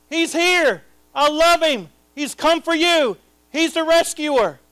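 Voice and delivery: high, fervent declaration; loud proclaiming voice. Acted. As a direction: proclaim it as the Father from heaven. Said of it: "The Father in a loud voice said".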